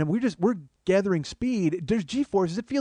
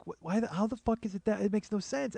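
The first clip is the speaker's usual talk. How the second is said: inner voice; 5 semitones higher, 6 dB quieter than usual. Retold or imitated. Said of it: "in my head".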